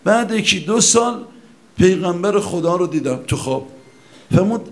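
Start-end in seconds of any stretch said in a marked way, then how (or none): none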